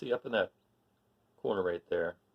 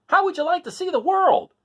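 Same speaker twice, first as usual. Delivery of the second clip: much higher, louder eager rooster; rooster's voice; 11 dB louder than usual. Acted. Rooster asking the cats to travel, high eager call. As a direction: chirp the invitation up high like a cocky rooster.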